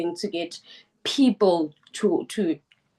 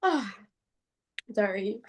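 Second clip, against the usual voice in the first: still crying; 5 dB quieter than usual. Real, not acted. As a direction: pull yourself together through tears. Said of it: "I'm gonna get emotional".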